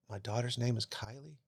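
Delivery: put-on hushed boyish voice of a man in shock; little boy voice. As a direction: ask it like a stunned little kid. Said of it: "This little boy voice came out of me".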